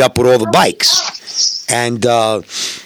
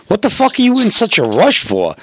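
retold cop's question, pitched up; lightly voiced cop, higher and incredulous; 10 semitones higher than usual. Cop asking rider why he's rushing, lightly imitated.

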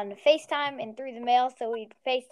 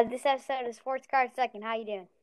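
ordinary talk, nothing marked

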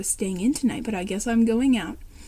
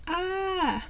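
high startled shriek; short scared scream; 7 semitones higher, 5 dB quieter than usual. Acted. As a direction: yelp at a sudden scare. Scream after Lucy's boo.

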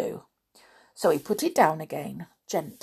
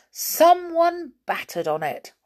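usual speaking voice

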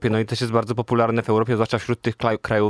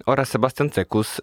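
normal talking voice

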